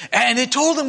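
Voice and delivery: usual speaking voice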